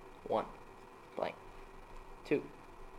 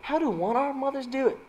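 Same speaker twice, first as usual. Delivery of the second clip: mock wife voice; 12 semitones higher than usual. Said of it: "and said".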